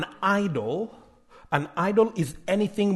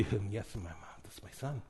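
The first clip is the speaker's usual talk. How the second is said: proud parents' murmur; 9 semitones lower, 18 dB quieter than usual. Retold or imitated.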